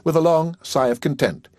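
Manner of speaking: ordinary delivery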